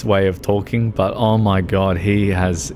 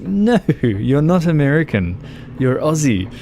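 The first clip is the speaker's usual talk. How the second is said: higher, a touch of playful scolding fan; mock indignant fan; 6 semitones higher than usual. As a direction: tease like a fan correcting a singer. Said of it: "It's like".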